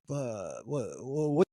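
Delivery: mimicked Russian, climbing high in pitch; hammed-up explaining of the obvious; baffled Russian. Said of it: "the Russians didn't have this safety chain".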